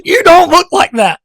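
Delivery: loud, high holler through a laugh; laughing, incredulous shout; exasperated callout. Acted. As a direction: call them out at full volume. Calling out fake profile pictures.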